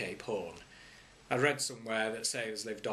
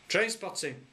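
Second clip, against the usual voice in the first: Midge's high innocent answer; a hint of a small boy fibbing; lightly voiced boy; child; 9 semitones higher, 3 dB louder than usual. A narrator's reading. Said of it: "said Midge".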